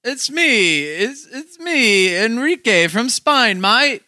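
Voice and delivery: high, slow, drawn-out Aussie-style "Spaniard"; mock Spanish accent. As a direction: joke Spanish accent that comes out broad Australian. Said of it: "a really good Spanish accent by [name]".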